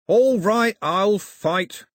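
loud, high, snarling acceptance. A narrator's reading. Cox takes up Milo's challenge, loud snarl. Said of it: "Cox snarled".

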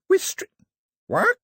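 very high, incredulous break-off; startled disbelief. A narrator's reading. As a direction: start to echo it, then squeak in surprise. Daphne breaks off, startled by answer.

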